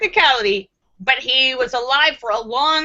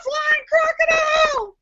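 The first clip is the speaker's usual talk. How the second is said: high, sudden mock shout at the idea; flying crocodile shout. Streamer picturing flying crocodiles, shouting in high mock alarm. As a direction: shriek it out like you've spotted one in the sky. Imagining crocodiles that can fly.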